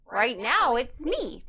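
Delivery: ordinary talk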